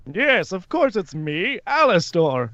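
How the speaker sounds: high, drawn-out theatrical greeting; swooping, showy character voice; Alastor, jovial and grand. Acted. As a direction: announce yourself in a high, lilting villain voice. Plays Alastor.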